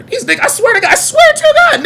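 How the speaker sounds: fed-up outburst climbing high; angry rant. Real, not acted. Streamer ranting at chat, high angry outburst.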